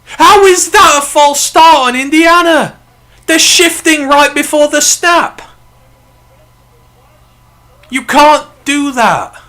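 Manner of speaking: loud, high, furious yelling at the refs; real furious shouting at the screen; real angry rant. Real, not acted. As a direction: rage at the shifting penalty call. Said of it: "even I'm getting mad now".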